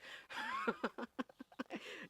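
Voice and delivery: high, wheezing run of laughter; bitter laugh. Acted. Director after revealing a family secret, bitter laughing. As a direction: laugh at your own painful story.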